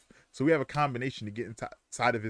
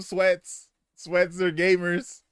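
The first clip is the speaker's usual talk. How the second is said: normal talking voice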